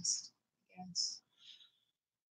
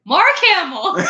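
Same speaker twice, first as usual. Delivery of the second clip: laughing; 13 semitones higher, 4 dB louder than usual. Real, not acted. Jokey pick, laughing.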